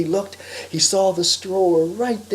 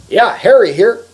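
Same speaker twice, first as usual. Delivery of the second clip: loud, higher phone answer; brisk phone greeting; 5 semitones higher, 12 dB louder than usual. Acted. Harry picks up the phone, loud greeting. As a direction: pick up and announce yourself loudly.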